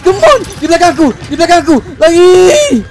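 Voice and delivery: very high, loud screaming; frantic yelling with the pursuer right behind; panicked. Real, not acted. Chase and escape in game.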